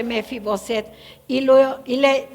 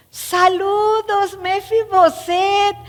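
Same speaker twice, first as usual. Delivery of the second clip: high, slow, delighted hello; warm admiring greeting; 6 semitones higher, much slower than usual. Acted. King David warmly greeting Mefiboset, high and slow. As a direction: greet him like a long-lost friend. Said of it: "las palabras del rey están ahí entre admiración".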